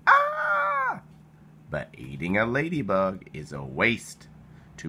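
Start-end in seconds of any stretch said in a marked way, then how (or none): 0.1-1.0 very high, loud shriek; a high loud cartoon scream; scared scream. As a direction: squeal in mock fright. Ladybug fleeing hungry bird.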